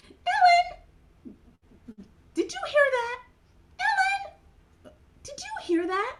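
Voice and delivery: a little puppet calling out to Ellen; Mimi puppet calling. Acted. Puppet Mimi calling to Ellen, very high and excited.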